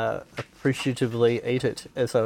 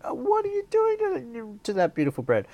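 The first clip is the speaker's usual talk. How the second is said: mock outrage; 12 semitones higher than usual. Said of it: "it's like".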